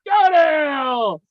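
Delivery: high, slow, hammed-up shout; mock furious yell. Host mock-yells at Jack in hammed-up fury. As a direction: bellow it like a wounded diva.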